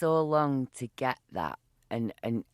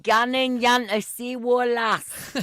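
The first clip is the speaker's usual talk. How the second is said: broad Geordie, high and loud; hammed-up Geordie accent; 9 semitones higher, 8 dB louder than usual. Shown as a demonstration. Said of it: "all taught me how to talk Geordie".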